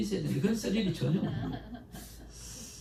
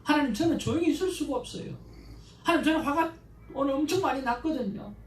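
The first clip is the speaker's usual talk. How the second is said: arguing with God; 6 semitones higher than usual. Shown as a demonstration. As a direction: pour out raw anger at God, pleading. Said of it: "완전 따지는 거예요". (하나님께 따지듯 높고 크게 항의하는 목소리)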